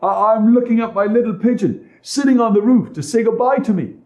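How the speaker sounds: put-on high, loud little boy voice; small boy Hansel. A narrator's reading. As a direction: answer like an innocent boy. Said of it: "answered Hansel".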